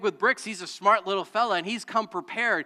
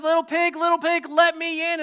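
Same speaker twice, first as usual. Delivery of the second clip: higher, louder wolf calling at the door; big bad wolf; 9 semitones higher, 7 dB louder than usual. Pastor voicing the wolf at the straw house, high and loud. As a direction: call sweetly but hungrily to the pig inside. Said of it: "he came up to the first house of straw and he said".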